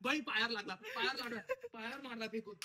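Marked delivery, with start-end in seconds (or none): 0.7-1.4 high startled yelp; pained cry. Real, not acted. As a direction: cry out in pain. Firecracker hit his foot.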